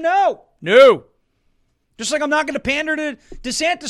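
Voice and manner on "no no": loud, punchy double no; emphatic no. Real, not acted. Refusing to pander to voters, loud no. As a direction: slam the door with two loud noes. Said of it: "Am I gonna pander to them?".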